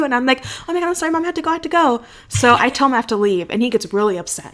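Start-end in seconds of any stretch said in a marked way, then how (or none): 0.7-2.0 high-pitched, hurried fake pleading; mock-panicked apology. Faking a call from mom to escape the date, panicked apology. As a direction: play up a flustered apology.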